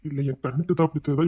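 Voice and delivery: ordinary talk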